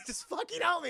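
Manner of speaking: high-pitched exasperated begging; comic strained plea; mock desperate plea. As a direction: beg for rescue, hammed up. Voices Jackie hanging, pleading.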